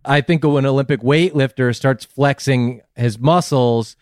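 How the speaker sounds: ordinary talk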